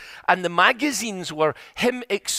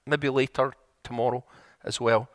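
usual speaking voice

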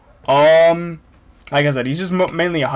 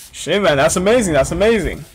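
usual speaking voice

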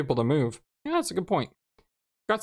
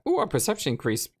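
ordinary delivery

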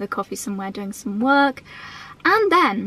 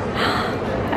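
delighted gasp. Real, not acted. Sees the tiny bag.

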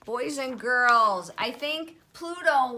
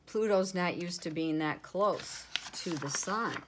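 plain reading voice

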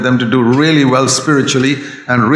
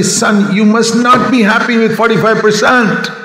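higher, pressing father to son; a father raising his voice, not angry; raised voice; 9 semitones higher than usual. Retold or imitated. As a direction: push the son, voice up. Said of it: "I may raise my voice and tell him".

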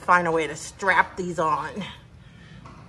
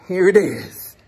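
normal talking voice

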